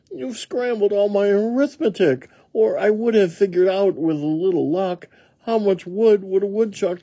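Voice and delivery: high-pitched complaining woodchuck voice; flustered woodchuck. A narrator's reading. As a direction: fuss over the muddled sums in a high voice. Woodchuck figure in story.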